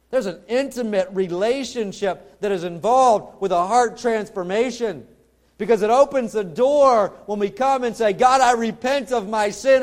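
loud, rising, fervent sermon voice; preaching at full force. Acted. Preacher urging his congregation to repent, in a loud fervent voice. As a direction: preach it out with mounting fervour.

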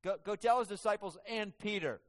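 joyful angel insisting on Peter; eager angel command. Acted. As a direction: press it so Peter surely hears. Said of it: "Isn't that great?".

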